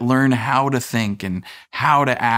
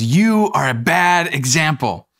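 slow, higher mock scold from the wife; imitating wife's scolding; 5 semitones higher than usual. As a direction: mimic the wife's slow, pointed rebuke. Wife scolding husband, retold.

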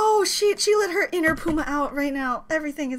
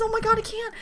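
ordinary talk, nothing marked

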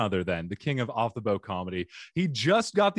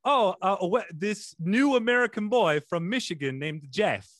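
higher, excited retold gushing; mock excited fuss; 9 semitones higher than usual. Relatives fussing over the new American boy, mock excited. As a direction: gush over the newcomer in a raised pitch.

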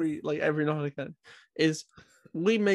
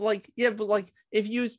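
ordinary delivery

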